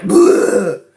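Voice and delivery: loud hammed-up puking noise; mock retch. Drunk puking on the cop's shoes, loud mock retch. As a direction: retch it out loudly.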